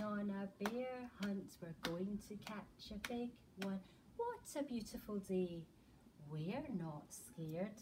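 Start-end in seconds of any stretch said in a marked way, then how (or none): none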